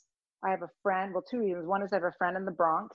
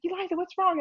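lightly voiced mother, pitched up; a hint of a worried mom; concerned mom; 12 semitones higher, faster than usual. Mom on the porch asks the upset girl, mildly pitched-up concern.

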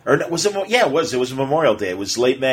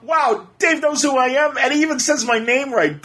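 fanboy glee, much higher; giddy excitement; 12 semitones higher than usual. Retold or imitated. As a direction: replay the starstruck thought with a giddy lift. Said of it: "all I could think was".

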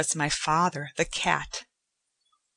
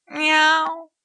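much higher imitated meow; cat's meow; 10 semitones higher, 6 dB louder than usual. A narrator's reading. The father as a cat answers Joanna with a much higher meow. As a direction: mew like a cat. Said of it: "my father said".

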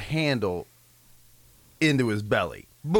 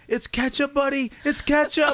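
higher, gentle reassuring tone; 11 semitones higher than usual. Retold or imitated. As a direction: soothe the crying brother. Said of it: "And I was like".

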